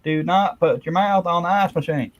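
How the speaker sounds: high, lightly voiced scold; a hint of a nagging wife; mock Marge voice. Viewer's quote read as Marge scolding Homer. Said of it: "Anthony said".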